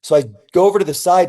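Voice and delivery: ordinary talk, nothing marked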